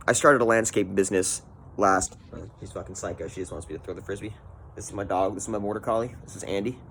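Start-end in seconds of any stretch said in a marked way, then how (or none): 2.6-6.8 softly introducing the dog to the camera; soft aside about the dog; quiet aside. Real, not acted. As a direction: murmur it aside, much softer.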